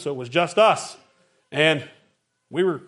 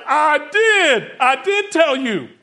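higher, louder, slowed insistence from the wife; exasperated wife; 9 semitones higher, 6 dB louder, much slower than usual. Retold or imitated. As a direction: stress that she did tell him. Wife answering his shout.

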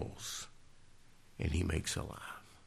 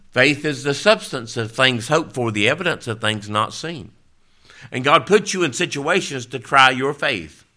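loud, ringing sermon voice; fervent preaching; 6 semitones higher, 9 dB louder than usual. Acted. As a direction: proclaim it like scripture from the pulpit. Preaching scripture with force.

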